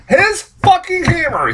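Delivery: high-pitched, exasperated emphasis; incredulous repeat. Real, not acted. Reviewer ranting about a hero jealous over his hammer, incredulous.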